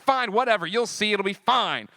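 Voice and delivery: put-on a defiant shrug of a reply to his worried girlfriend; dismissive. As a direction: wave her off, fine, whatever. Brushing off girlfriend's worry.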